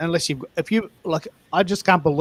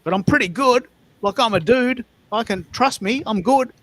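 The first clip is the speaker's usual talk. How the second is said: higher, louder boastful guy voice; cocky pitcher; 9 semitones higher, 6 dB louder than usual. Retold or imitated. Mocking the pitcher who claims he's good, high and loud. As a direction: brag like a cocky salesman. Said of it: "And he goes".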